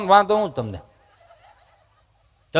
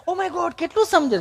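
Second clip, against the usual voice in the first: high, prissy mock amazement; affected city speaker; 10 semitones higher, 5 dB quieter than usual. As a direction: marvel like an affected city visitor. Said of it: "ચીપી ચીપીને Gujarati બોલે".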